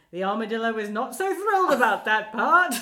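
loud muffled armadillo voice; muffled inside armadillo shell. Retold or imitated. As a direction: boom it out from inside the costume. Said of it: "he's doing his, his armadillo voice".

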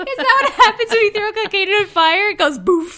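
laughing. Real, not acted. Laughing question about cocaine fire.

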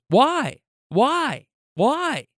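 put-on high little-kid voice, repeated questioning; Baby Phil. Toddler pestering his aunt in the car, imitated kid voice. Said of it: "A million questions".

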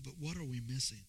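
ordinary delivery

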